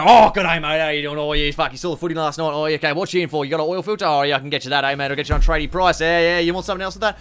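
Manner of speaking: high, chirpy broad Aussie sales patter; as Aussie as possible. Retold or imitated. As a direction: lay on broad Aussie mateship to a tradie. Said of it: "You wanna be as Aussie as possible".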